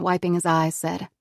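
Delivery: ordinary delivery, nothing marked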